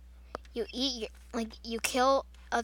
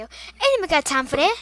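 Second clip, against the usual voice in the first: meme quote; 3 semitones higher, 8 dB louder than usual. Retold or imitated. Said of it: "you guys all probably know that video".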